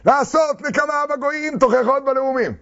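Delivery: high-pitched mock recitation; imitated prayer. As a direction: mimic worshippers reciting aloud. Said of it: "שמעתי אותם".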